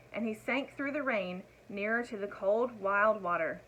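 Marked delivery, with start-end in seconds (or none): none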